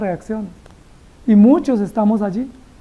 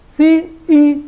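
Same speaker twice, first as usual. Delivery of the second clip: high, louder snippy retort; defiant sass; 6 semitones higher, 3 dB louder than usual. Acted. Jonah snapping back at God with high sass. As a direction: talk back like a sulky teen.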